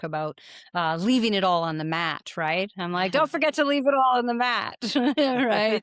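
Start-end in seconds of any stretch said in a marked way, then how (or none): 3.1-4.8 higher mock-cheery send-off; playful reminder. Friend reminding friend before yoga, playfully calling out. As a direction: call it out like a running joke. Retelling a running joke with friend.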